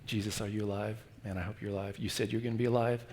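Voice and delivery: soft, low, anxious prayer; hushed anxious plea; prayer-like pleading. Acted. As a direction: plead quietly, as if praying. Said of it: "He's almost prayer-like".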